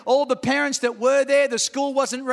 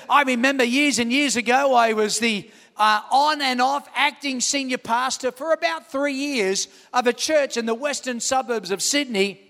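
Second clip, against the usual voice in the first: usual speaking voice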